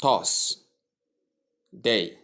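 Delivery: "toss" with a t and o vowel, pause, "day"; mispronunciation. Shown as a demonstration. Teacher showing learners wrong Thursday, mispronunciation. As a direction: say toss, then day, as two separate pieces, t for th.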